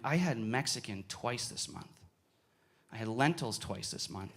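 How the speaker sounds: low, very soft self-talk; dawning realisation. Retold or imitated. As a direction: let it dawn on you quietly. Quiet realisation after it dawned.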